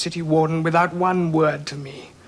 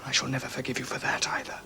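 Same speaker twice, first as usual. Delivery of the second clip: cold whisper, stress on never; whispered vow; 5 semitones lower, 12 dB quieter than usual. Acted. Son to his father, a whispered vow of hatred. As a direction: breathe the vow quietly, hard on never.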